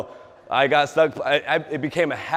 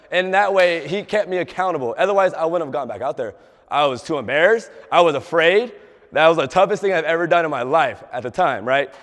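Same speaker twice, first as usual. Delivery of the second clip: ordinary delivery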